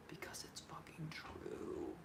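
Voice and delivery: slow, breathy whispered repeat; a slow whispered echo of the line before. Real, not acted. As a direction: hush it down and drag it out. Repeats the line, whispered second time.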